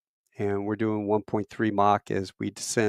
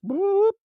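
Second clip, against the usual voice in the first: mock boop. Blacking out under G.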